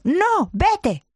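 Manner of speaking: voz aguda y fuerte de niña enfadada. Retold or imitated. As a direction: rechaza el abrazo como una niña furiosa. Hija enfadada rechaza el abrazo. (la hija enfadada rechaza a mamá, aguda y fuerte)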